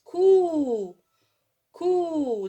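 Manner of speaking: "cou" with a long held oo; drawn-out cou. Shown as a demonstration. Teacher showing pupils how C joins ou, long drawn-out cou. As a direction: stretch the vowel of "cou" right out. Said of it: "elle se prononce".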